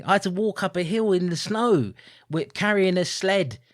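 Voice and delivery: normal talking voice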